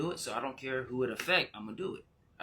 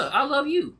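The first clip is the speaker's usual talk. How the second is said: high loud cry; 14 semitones higher, 9 dB louder than usual. Real, not acted. Emotional outburst.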